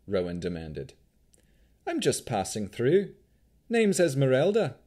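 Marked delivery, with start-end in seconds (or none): 1.9-4.8 put-on breezy Vistani accent; jaunty Vistani accent, high. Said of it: "Vistani accent".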